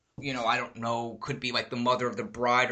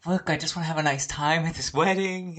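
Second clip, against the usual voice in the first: higher mock pleading; imitated wife's plea; 6 semitones higher than usual. Wife to speaker at the wedding, imitated exasperated plea. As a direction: plead for a bit of peace.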